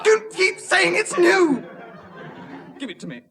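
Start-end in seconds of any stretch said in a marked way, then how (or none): 0.0-1.6 high, loud, drawn-out outburst; exasperated yell. Acted. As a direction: shout it in sheer exasperation. Exasperated official snaps at traveller.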